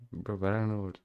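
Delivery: ordinary talk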